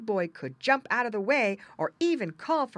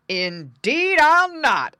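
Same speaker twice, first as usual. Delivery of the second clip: loud, slow, raised bear refusal; exclaiming bear; 8 semitones higher, 12 dB louder, much slower than usual. A narrator's reading. Bear refuses the pig's plea, exclaiming. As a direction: refuse emphatically, stretching each word. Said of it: "exclaimed the bear".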